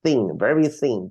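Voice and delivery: ordinary talk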